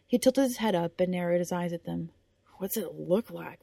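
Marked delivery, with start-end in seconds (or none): 2.6-3.6 winded. Acted. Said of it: "wind knocked out of him".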